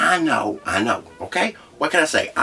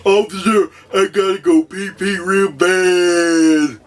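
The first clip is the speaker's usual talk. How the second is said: slow, high, loud whiny plea; best slow voice; 9 semitones higher, 5 dB louder, slower than usual. Retold or imitated. Speeder pleading with the officer, slow whiny voice. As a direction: drag it out like a desperate child. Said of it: "my best slow voice".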